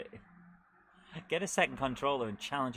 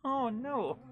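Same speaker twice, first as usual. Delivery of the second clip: dismay; 11 semitones higher than usual. Real, not acted. Losing the race.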